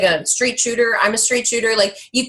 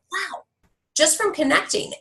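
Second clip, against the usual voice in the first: higher, hushed awe; soft amazed wow; 7 semitones higher, 3 dB quieter than usual. Retold or imitated. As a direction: marvel quietly at the luck. Retold amazement.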